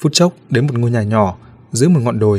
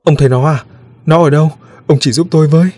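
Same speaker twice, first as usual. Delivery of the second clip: mother's urgent questions, higher and louder; faltering plea; 5 semitones higher, 5 dB louder than usual. A narrator's reading. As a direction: plead anxiously for help finding the child. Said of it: "Người đàn bà lập bập". (người mẹ lập bập cầu khẩn)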